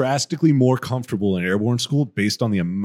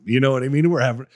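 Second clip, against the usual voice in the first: usual speaking voice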